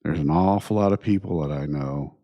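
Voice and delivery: usual speaking voice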